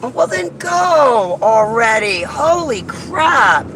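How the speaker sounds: slow exasperated shout; frustrated shout. Real, not acted. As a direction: let the frustration burst out. Yelling at traffic.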